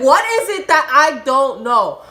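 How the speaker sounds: high, loud retold challenge; heated acted-out question; angry demand. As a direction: loudly demand the hidden truth. Acted quarrel with friend.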